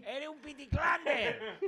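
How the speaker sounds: high, slow comic catchphrase; hammy comedian impression; Chiquito de la Calzada. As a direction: stretch out the nonsense word in a high put-on voice. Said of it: "¿cómo imites a Chiquito?".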